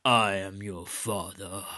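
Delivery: low, slow menacing Vader voice; Darth Vader. Retold or imitated. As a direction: drop the pitch and drag each word out. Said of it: "he's like".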